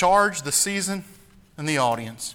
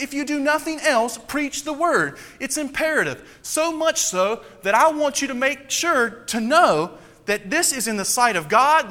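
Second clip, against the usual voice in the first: higher, louder urgent plea; fervent preaching; 4 semitones higher, 3 dB louder than usual. Acted. Pastor voicing Paul's urgent charge to Timothy, fervent. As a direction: plead the charge earnestly.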